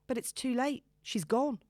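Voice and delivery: ordinary delivery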